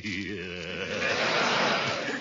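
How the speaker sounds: low, rolling, self-satisfied chuckle; sly chuckle. Acted. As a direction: laugh like a man who's sure he's got her. Throckmorton's smug answer to Leela.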